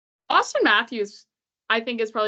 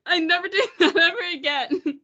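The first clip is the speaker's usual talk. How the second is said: real laughter breaking into the words; laughing, embarrassed vow; 7 semitones higher than usual. Real, not acted. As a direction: giggle through the line. Laughing at her failed accent.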